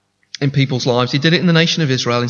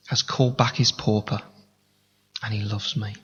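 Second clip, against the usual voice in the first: earnest prayer fading to a hush. Acted. Models a closing prayer.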